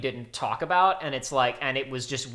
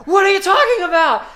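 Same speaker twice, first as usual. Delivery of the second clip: incredulous outburst; 11 dB louder than usual. Retold or imitated. Retelling friend's outraged confusion.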